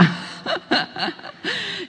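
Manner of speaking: bright high laugh at her own joke; high burst of laughter. Real, not acted. (risada aguda)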